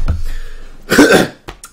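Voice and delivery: a rough, dry cough from being sick; real cough. Real, not acted. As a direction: cough out what's stuck in the throat. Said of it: "had to get that cough out".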